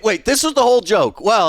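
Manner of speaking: normal talking voice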